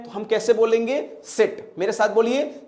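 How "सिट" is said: 'सिट' with a brief i; quick short i in 'सिट'; short vowel. Shown as a demonstration. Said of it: "ये छोटी ई है".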